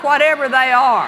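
ordinary talk, nothing marked

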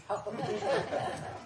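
bright giggle; high delighted laugh. Real, not acted. Laughter at "I'm not.

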